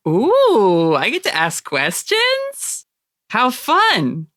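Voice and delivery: high, drawn-out delighted cooing; relishing the chance; gleeful delight. Real, not acted. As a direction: savor it with swooping, giddy pitch. Game keeper delights in asking questions.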